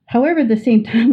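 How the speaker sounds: usual speaking voice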